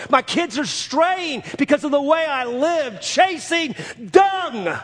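high, loud, slow climax build; preaching at full force. Acted. Preacher voicing a dying man's repentance, full-force preaching.

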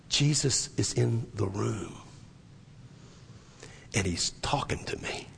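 slow, hushed, low voice fading to a whisper; hushed confiding. Retold or imitated. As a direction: retell it as an awed, secret confidence, trailing into whisper. Dying brother confides in retelling.

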